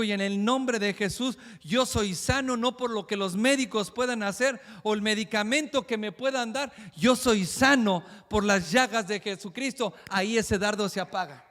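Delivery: fiery sermon declaration; forceful preaching. Acted. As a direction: proclaim healing with conviction.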